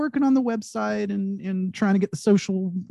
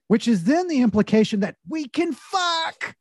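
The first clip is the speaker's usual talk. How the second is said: ordinary delivery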